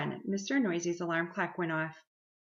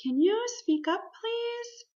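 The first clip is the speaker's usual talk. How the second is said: higher, sing-song feigned puzzlement; pretending not to hear; 3 dB louder than usual. A narrator's reading. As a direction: play innocently hard of hearing. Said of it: "putting her hand to her ear".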